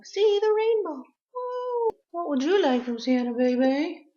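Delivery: high, soft pet-talk. Real, not acted. Owner coos to her pet about Santa, high and soft. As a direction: coo sweetly to the pet.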